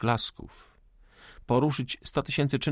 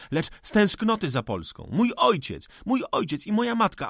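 raised high, passionate accusation; heated outburst; 5 semitones higher than usual. Acted. Cezary rails at the old forester, high and furious. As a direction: hurl it at him like an indictment. Young man's furious tirade.

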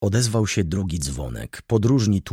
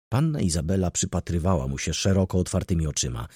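ordinary delivery, nothing marked

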